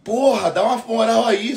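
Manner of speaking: 1 mock MC hype call. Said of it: "só falta fazer".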